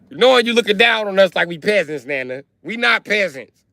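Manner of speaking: high, loud indignant complaint; indignant protest. Retold or imitated. Grandson protesting to nana, high and indignant. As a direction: protest like she insulted you.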